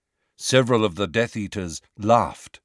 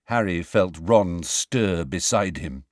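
ordinary delivery

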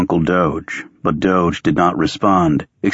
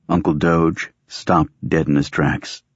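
plain reading voice

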